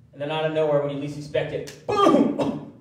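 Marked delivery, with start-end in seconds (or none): 1.9-2.7 an explosive, breathy high "boom!"; sudden boom. Acted. Preacher bursting out to his congregation, high and sudden. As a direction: burst out with it like a blast.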